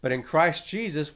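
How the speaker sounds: usual speaking voice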